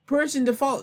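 high-pitched mock gushing; mock admirer. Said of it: "that you're gonna say".

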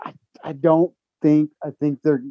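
halting, restarting each thought; hesitant stammer with broken-off starts. Real, not acted. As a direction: stumble over the words as you search for them. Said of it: "I'm stammering so much".

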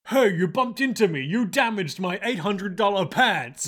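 tough thug voice hassling a passerby; yakuza shakedown. Retold or imitated. Bully shaking down a stranger, mock tough thug voice. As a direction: lean on him like a street bully. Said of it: "where it's like".

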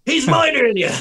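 high, shouted ringside urging; Rocky cornerman. Retold or imitated. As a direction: yell them on from the boxing corner. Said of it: "you watch Rocky? She was in my corner, like".

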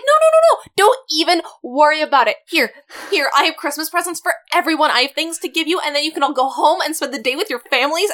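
very high, loud, rushed reassurance; manic reassurance. Retold or imitated. Boss assures late staff, high giddy rush. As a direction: brush off their worry in a giddy rush. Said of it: "And she's like".

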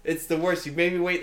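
usual speaking voice

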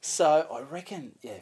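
usual speaking voice

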